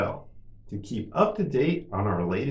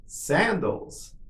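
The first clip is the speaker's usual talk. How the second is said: somewhat higher, shorter take; quicker model; 6 semitones higher than usual. Shown as a demonstration. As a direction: say it a little quicker, pitch lifted. Teacher models word for repetition.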